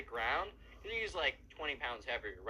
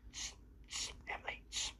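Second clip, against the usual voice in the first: softer, breathy hushing; mock whisper; 7 dB quieter than usual. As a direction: hush her conspiratorially so the counselor won't hear. Emo kid sneaks over to Emily.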